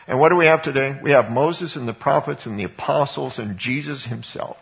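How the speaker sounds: ordinary delivery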